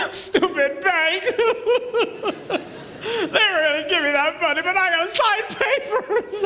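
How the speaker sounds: high, drawn-out fake sobbing complaint; mock whining. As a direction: whine like a cheated loan applicant, hammed up. Mock complaint about signing bank papers.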